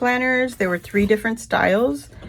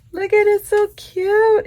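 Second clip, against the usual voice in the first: delighted squeal; 14 semitones higher, 6 dB louder than usual. Real, not acted. Gushing over gold planner.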